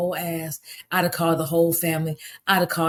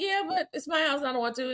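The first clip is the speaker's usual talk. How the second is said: daughter; 7 semitones higher, 6 dB quieter than usual. Retold or imitated. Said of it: "She was like".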